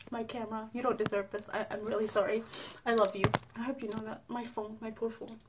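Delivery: soft guilty coo; tender apology. Real, not acted. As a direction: soothe the poor phone. Apologising to phone after screaming.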